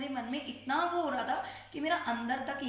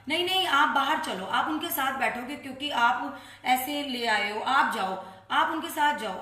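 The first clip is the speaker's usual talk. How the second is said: louder, firm order to leave; stern scolding; 6 dB louder than usual. Retold or imitated. Sevadar ordering the aunty out of the temple, loud stern scolding.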